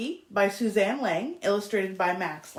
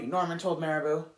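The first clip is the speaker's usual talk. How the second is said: plain reading voice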